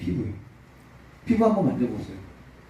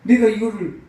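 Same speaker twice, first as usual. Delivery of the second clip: raised, higher voice in indignant complaint; angry protest; 6 semitones higher, 4 dB louder than usual. Retold or imitated. Abbot complaining angrily to God, raised voice. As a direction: protest hotly to God, pitch up. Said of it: "그 수도원장이 걸어 화가 나는 거예요".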